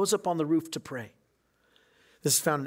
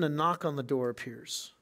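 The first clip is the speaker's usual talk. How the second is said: ordinary talk, nothing marked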